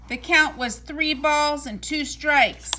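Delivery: ordinary delivery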